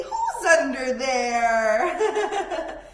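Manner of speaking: hushed lean-in then a loud laughing "who's under there"; playful call. Pretend. Teacher calling under the bed to kids, laughing.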